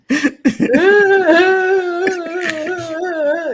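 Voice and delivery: long high wailing sob; exaggerated high boo-hoo wail; mock crying. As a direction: imitate a kid bawling. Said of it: "this dude just started crying".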